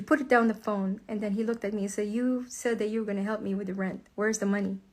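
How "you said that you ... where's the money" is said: imitating his cold, low demand; low, quieter voice, coldly asking for what's owed. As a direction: mimic a partner's chilly matter-of-fact demand. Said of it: "So cold, just like that".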